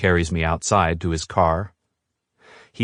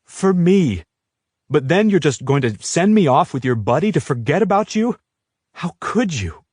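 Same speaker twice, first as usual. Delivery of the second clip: angry outburst; 8 semitones higher than usual. A narrator's reading. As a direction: tear into him for giving up. Heroine keeps raging at Jared.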